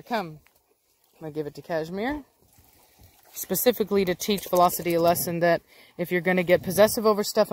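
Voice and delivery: ordinary talk, nothing marked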